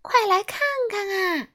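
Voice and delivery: high, carrying call to the crowd; bright calling voice. Acted. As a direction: summon everyone from afar. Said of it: "她扯着嗓子喊道".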